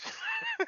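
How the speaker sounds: high cackling witch laugh; witchy cackle. Real, not acted. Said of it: "I sound a little like the witch".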